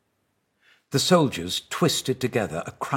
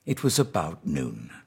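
ordinary delivery, nothing marked

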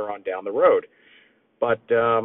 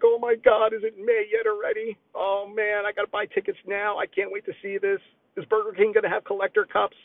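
put-on much higher, giddy little kid voice; excited kid; 11 semitones higher than usual. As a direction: squeal it like an impatient kid counting days. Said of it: "the kid in me is just like".

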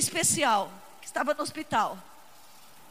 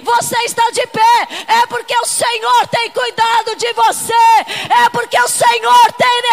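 loud, fervent preaching cry; full-force preaching; 6 semitones higher, 11 dB louder than usual. Acted. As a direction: preach it to the congregation with fire.

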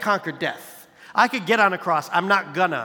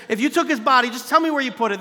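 higher and louder, exasperated; frustrated demand; 7 semitones higher, 6 dB louder, faster than usual. Retold or imitated. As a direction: push it like a desperate accusation. Mary demanding where the body is.

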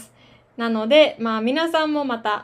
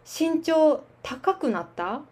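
slow demo; 3 semitones higher than usual. Shown as a demonstration. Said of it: "example sentence demo".

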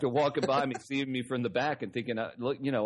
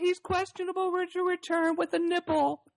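high, bright mock TV reporter; sideline reporter voice.

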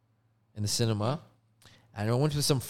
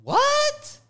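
very high, loud disbelief; shocked what; 7 dB louder than usual. Real, not acted. Reacting to his friend calling the popcorn bad, shocked. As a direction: yelp it in disbelief.

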